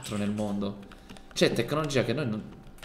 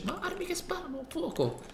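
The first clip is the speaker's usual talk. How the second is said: amazed; 5 dB quieter than usual. Acted. Marveling at firearms.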